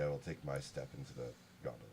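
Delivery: timid murmur, stepping in nervously; sheepishly edging into the swan gondola, hushed and quick. Acted. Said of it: "very sheepishly".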